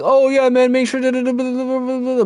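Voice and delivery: high, breezy, dismissive chatter; mock chat host voice. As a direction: rattle it off like a distracted streamer. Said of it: "I'm like this on his chat".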